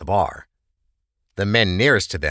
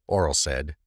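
plain reading voice

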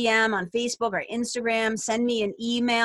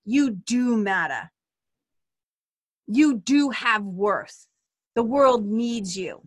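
tender affirmations; slower than usual. Real, not acted. Host affirms hurting listeners' worth, slow and tender. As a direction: lay each "you are" down gently, like a blessing.

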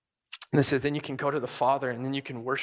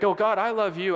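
mock gushing worshipper; 8 semitones higher, 5 dB louder than usual. Mocking fake worshipper.

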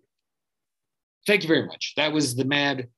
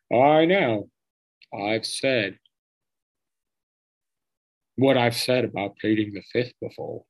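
terrible Trump impression. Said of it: "that's a terrible Trump".

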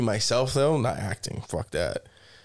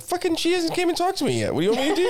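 high-pitched, hammed-up exasperation with laughter; mock defensive protest; faster than usual. Contestant defending himself over not talking to Emily, high mock exasperation. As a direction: plead your case in a comically high voice.

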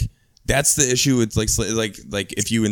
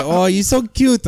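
high, slow, loud cooing; doting grandma; 11 semitones higher, 7 dB louder, slower than usual. Retold or imitated. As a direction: coo over the grandkid like a proud abuela. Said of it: "She'd be like".

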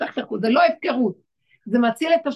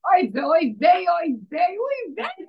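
mournful lament; 5 semitones higher than usual. Retold or imitated. Rabbi lamenting the exile at midnight, crying wail. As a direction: wail it like a grieving father. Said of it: "והוא קם בלילה ואומר".

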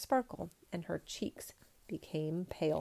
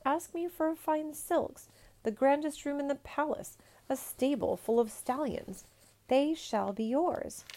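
pleading offers; 7 semitones higher than usual. Acted. Emperor offers the peasant riches, high insistent pleading. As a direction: tempt him with riches.

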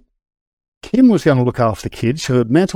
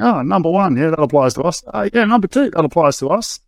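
mock joking; 8 semitones higher than usual. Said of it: "we were sort of joking".

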